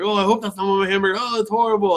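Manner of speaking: high, quick hammed-up dying lament; pretend dying. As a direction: play a puppeteer faking his death. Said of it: "he pretends to die".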